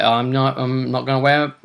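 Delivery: ordinary delivery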